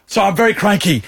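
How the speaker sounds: high-pitched cranky declaration. Retold or imitated. Bitten woman explains herself to the lady, cranky. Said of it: "'cause I'm so cranky".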